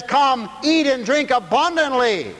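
high, slow preacher's proclamation; declaimed scripture. Real, not acted.

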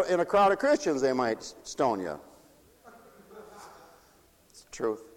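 usual speaking voice

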